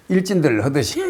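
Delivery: light laugh breaking out after the words; amused laughing. Real, not acted. Teacher joking to students about swaggering like bullies, laughing. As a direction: say it through a chuckle.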